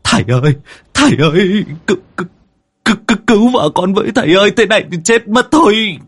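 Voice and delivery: high, stammering plea for help; desperate, high-pitched wail; frantic panic. A narrator's reading. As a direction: beg the master, tripping over the words. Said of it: "Anh Tín cuống cuồng".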